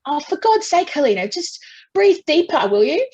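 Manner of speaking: high, loud mock exasperation from husband; exasperated husband. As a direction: snap it like a frustrated husband. Husband's frustrated retort retold.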